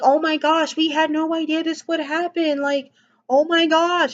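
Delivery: mock innocent cheer. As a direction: play it sweet while being petty. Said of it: "sort of very cheerful".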